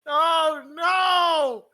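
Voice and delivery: high, loud despairing cry; anguished shout. Acted. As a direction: wail it to the sky. Character despairing loudly.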